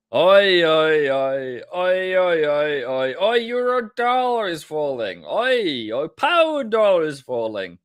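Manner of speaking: loud wailing "oi, oi, oi"; mock lament. Trader reacting as each dollar is falling, mock lament. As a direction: wail it out in hammed-up dismay.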